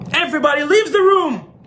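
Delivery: high, wailing royal order; high-pitched outburst; upset king. Retold or imitated. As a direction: clear the room in a sudden upset. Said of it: "and he gets upset".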